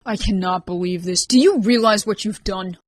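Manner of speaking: exasperated. Acted. Ryan confronts Rain, exasperated.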